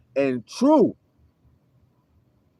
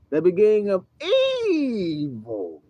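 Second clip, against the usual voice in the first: EVILS stretched long on a raised, falling pitch; drawn-out EVILS; 3 semitones higher, slower than usual. Shown as a demonstration. Preacher reading scripture on the beginning of evils, EVILS dramatically drawn out. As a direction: draw out the first vowel of evils. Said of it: "You gotta say it like that too".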